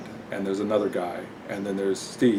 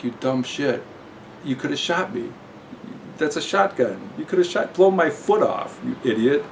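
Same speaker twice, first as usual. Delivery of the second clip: rising, higher indignant rant; angry scolding; 6 semitones higher than usual. Retold or imitated. Steve berating the careless shooter, rising in anger. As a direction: chew him out for nearly shooting.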